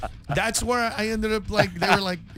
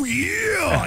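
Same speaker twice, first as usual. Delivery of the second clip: high shouted grunt of a rough wrestler; mock brute shout; 11 semitones higher, 3 dB louder than usual. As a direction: bark it like a careless bully. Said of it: "don't be like".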